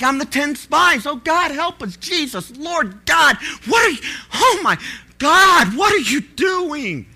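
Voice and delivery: a pastor hearing his church must move; panicked prayer. Retold or imitated. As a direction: shout it up to heaven in panic. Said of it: "And I'm like".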